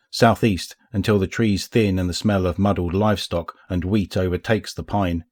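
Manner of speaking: plain reading voice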